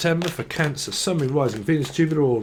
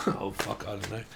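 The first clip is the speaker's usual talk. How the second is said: ordinary delivery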